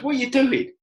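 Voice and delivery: high, loud surprised call; mock the big South African lad calling out; army lad's challenge. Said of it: "And, uh, he goes".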